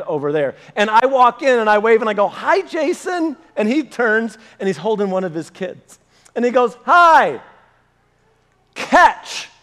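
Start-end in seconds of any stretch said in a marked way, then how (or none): 2.3-3.4 high, drawn-out hello; friendly called greeting. Retold or imitated. Preacher waving to a friend across the lobby, high greeting. 6.9-9.5 high, loud mock call; acted call-out. Friend calling across lobby as he throws baby, acted call.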